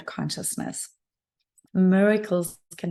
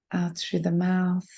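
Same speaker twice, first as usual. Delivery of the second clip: guided meditation. Real, not acted. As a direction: guide them gently inward, phrase by unhurried phrase.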